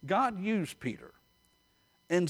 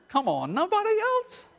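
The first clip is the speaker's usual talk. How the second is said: high-pitched, incredulous appeal; coaxing the congregation; 3 dB louder than usual. Real, not acted. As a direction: pitch leaps up, prodding the crowd to own up.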